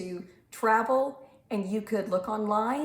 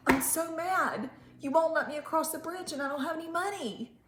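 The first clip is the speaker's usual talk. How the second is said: mildly whiny upset dog, pitched up; mock crying complaint; 7 semitones higher than usual. A narrator's reading. Storyteller imagining Clifford stuck at the bridge, mock upset. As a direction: complain in a higher, put-on upset voice. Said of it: "could have just cried and said".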